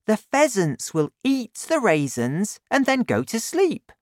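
put-on excited boy laying out his plan; lightly voiced boy, thrilled with his idea; young Danny.

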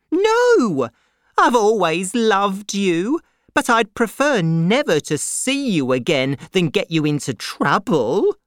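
high, pleading protest; crying out. A narrator's reading. Miss Pross assures her brother of her love, crying out. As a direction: protest it high, opening on a loud cry. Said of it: "cried Miss Pross".

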